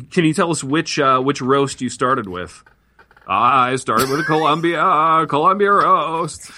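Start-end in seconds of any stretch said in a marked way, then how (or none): 3.3-6.5 put-on slow, high old-Hollywood drawl; drawn-out impression, rising higher on the repeat; Jimmy Stewart. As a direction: stretch the words out like a classic film star. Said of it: "[name] sounded an awful lot like Jimmy Stewart".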